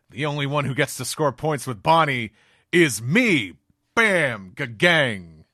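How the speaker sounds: loud, high cocky battle cry; mock hero shout; hammed-up boast. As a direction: crow it as you kick. Sanji's boastful attack line.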